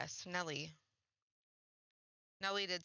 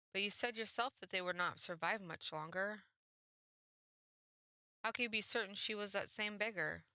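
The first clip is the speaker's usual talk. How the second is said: ordinary talk, nothing marked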